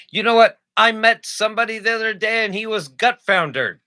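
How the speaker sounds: high, loud put-on mid-Atlantic voice; mid-Atlantic accent. Reading definitions to co-host, hammy mid-Atlantic accent.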